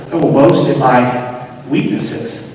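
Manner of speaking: hushed, low, with weighty pauses; quiet scripture quote. Retold or imitated. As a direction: quote it softly, letting each phrase settle. Quotes Paul's humble boast.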